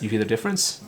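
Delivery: usual speaking voice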